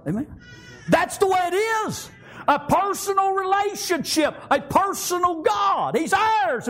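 high shouted preaching. Acted. Preacher telling the church they have a personal God, shouted. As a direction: lift it up and shout it out.

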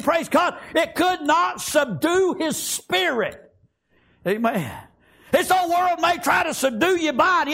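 high, driving sermon delivery; fervent preaching. Real, not acted. Preacher urging the congregation to keep pressing further, fervent preaching. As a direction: preach it hard in rolling phrases.

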